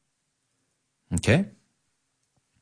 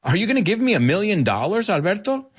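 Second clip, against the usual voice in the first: hopeful asker; 7 semitones higher, faster than usual. Shown as a demonstration. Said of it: "if somebody says".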